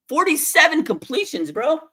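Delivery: shocked yelp over the completions; stunned disbelief. Real, not acted. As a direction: blurt it out like you can't believe it. Shocked at opponent's record.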